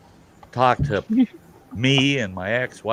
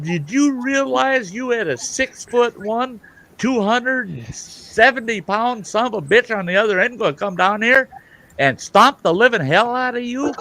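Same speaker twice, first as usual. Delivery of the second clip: retold angry tirade; 11 semitones higher than usual. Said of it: "I'm bent out of shape".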